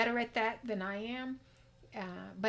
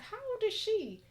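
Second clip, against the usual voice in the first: mock amazement; 12 semitones higher than usual. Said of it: "I'll be like".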